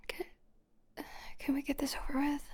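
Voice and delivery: fast, breathless whisper; hurried whisper. Acted. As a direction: rush it out in a whisper. Humiliated during a strip search.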